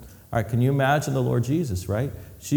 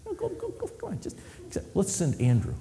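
urgent hushed go-go-go, high and soft; hushed urging; 7 semitones higher, 8 dB quieter than usual. Retold or imitated. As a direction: nudge a friend under your breath. Disciples pushing each other to go.

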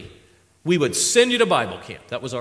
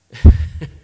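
laugh; 16 dB louder than usual. Real, not acted. Laughs at own joke.

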